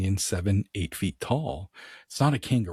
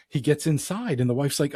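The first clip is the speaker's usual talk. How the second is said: ordinary delivery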